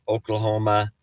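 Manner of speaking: ordinary delivery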